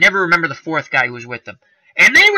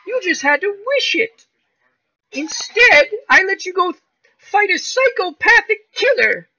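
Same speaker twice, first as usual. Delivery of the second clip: much higher put-on good witch voice, laughing through it; mock Glinda. Host mocking Glinda to Dorothy, high laughing voice. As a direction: ham up a sweet witch scolding. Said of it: "she's like".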